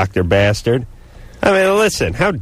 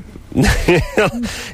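laughing; 11 semitones higher than usual. Real, not acted. Teasing co-host after a friendly jab.

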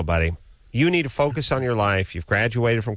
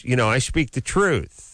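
ordinary delivery

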